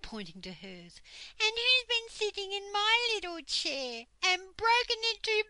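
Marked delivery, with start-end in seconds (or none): 1.4-5.4 very high, loud squeal; squeaky, tearful baby bear. A narrator's reading. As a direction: wail it out as a little bear cub. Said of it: "squeaked the baby bear in tears".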